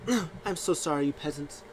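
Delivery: high, condescending mock diva; haughty diva. Zelina sneering at the crowd, lightly high diva. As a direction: talk down to the peasants.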